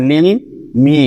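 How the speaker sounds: লি and মি apart, final t left off; wrongly split syllables. Shown as a demonstration. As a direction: say লি, pause, then মি without its closing t. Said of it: "এভাবে কিন্তু আপনি বলতে পারছেন না".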